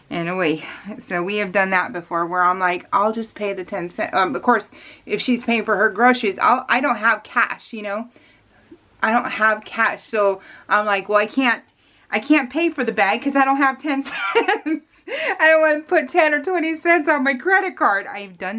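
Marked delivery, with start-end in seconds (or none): none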